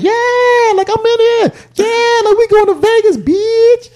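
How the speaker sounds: hammed-up party girl; loud woman passenger. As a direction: mimic her yelling to the plane. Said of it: "the chick was like".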